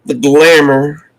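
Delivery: measured recitation with drawn-out line endings; poem reading. Acted. Host reads a D H Lawrence poem to viewers, measured poem reading. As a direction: recite it steadily, pausing between phrases.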